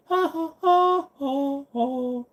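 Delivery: high, spaced-out fake "ha ha"; hollow high ha-ha-ha; mock laugh. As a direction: fake laugh, one slow hoot at a time. Mock laugh at Baldwin question.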